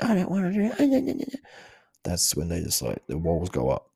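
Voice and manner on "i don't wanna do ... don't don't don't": put-on high whiny kid voice, much higher; hammed-up sulky child protesting to parents; mock tantrum. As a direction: whine it like a stroppy teenager. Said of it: "As soon as you start losing your shit".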